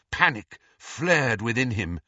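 ordinary delivery, nothing marked

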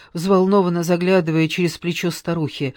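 usual speaking voice

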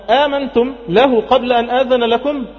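high, slow, forceful challenge; indignant Pharaoh. Acted. Pharaoh confronts the converted magicians, indignant challenge. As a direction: demand an answer in outrage.

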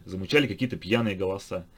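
ordinary delivery, nothing marked

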